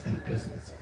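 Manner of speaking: Helplessness breathed as a bare whisper. Shown as a demonstration. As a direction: let Helplessness fall away into a whisper. Word repeated, second whispered.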